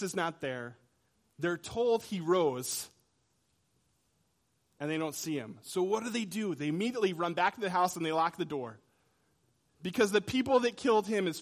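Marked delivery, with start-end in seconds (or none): none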